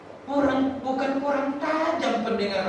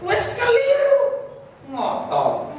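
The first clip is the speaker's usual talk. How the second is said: mocking scold; 13 semitones higher than usual. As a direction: jeer at the stubborn one. Preacher mocks stubborn believer.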